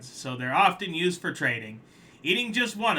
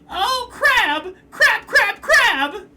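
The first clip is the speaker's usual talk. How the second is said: much higher, much louder crying crab oaths; despairing wail; 12 dB louder, much slower than usual. Acted. Crab crying after losing his shell, loud high wail.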